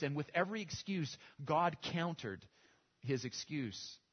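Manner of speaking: ordinary talk, nothing marked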